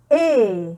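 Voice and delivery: "et" as a long open è, loud; drawn-out è. Shown as a demonstration. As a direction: hold "et" as a stretched open vowel. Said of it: "il est prolongé".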